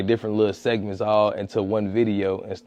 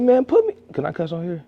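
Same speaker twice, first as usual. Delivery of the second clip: excited retort; 9 semitones higher, faster than usual. Retold or imitated. Replying to the other creators, acted excited demand. Acts out his eager reply.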